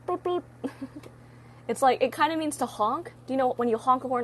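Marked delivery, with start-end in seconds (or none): 0.1-0.4 squeaky-high pair of toots; toot imitation. Shown as a demonstration. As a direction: mimic a little horn tooting. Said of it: "toot, it means like".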